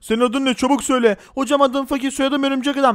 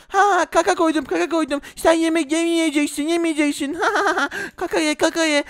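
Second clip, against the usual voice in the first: a slightly raised brat voice; lightly voiced bully; 5 semitones higher than usual. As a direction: gloat over the prank. Bully prank taunting.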